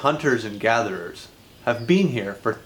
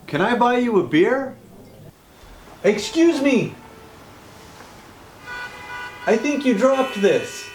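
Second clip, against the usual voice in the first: high, sugary-polite Canadian voice; overly nice Canadian; 11 semitones higher than usual. Acted. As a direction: play the impossibly nice Canadian, pitched way up.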